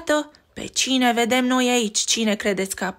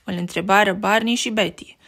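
ordinary delivery, nothing marked

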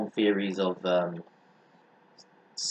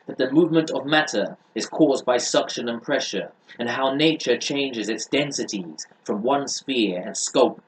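steady, earnest read-aloud of a treatise; book reading; 5 semitones higher, 7 dB louder than usual. Acted.

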